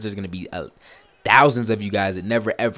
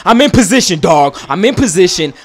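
loud, high pleading shout; exasperated yell; 11 semitones higher, 15 dB louder than usual. Real, not acted. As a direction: shout at Larry in disbelief. Yelling at teammate Larry.

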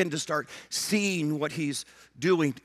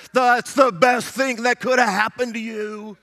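mock yell, higher, louder and slower; imitated scream; 5 semitones higher, 6 dB louder, slower than usual. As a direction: blurt it like a frustrated youth pastor. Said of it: "I just wanna scream at her".

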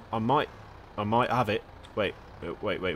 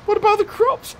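very high squeaky plea; high-pitched fretting over the crops; mock-alarmed protest. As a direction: protest in a comic falsetto.

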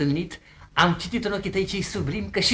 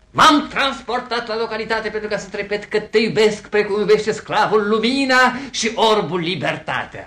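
loud, raised declaiming; pompous romantic oratory; grandiose declaration; 4 semitones higher, 6 dB louder than usual. Acted. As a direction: proclaim love like an orator. Suitor declaims his love.

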